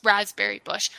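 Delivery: usual speaking voice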